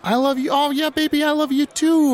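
squealing high, loud affection; gushing. Acted. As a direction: gush it out like a lovestruck fan. Badger gushes, very high.

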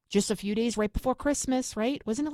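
ordinary talk, nothing marked

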